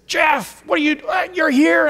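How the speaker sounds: put-on high, excited surprise at seeing an old friend; light up with surprise; surprised greeting. Old student spotting him at the seminary, surprised and high.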